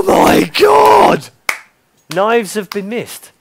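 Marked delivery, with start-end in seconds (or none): 0.0-1.3 high, loud, slow drawn-out shout; stunned shout. Real, not acted. Caster yells to viewers as the knives are missed, slow and loud. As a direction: stretch it out in total shock. Caster reacts to live play.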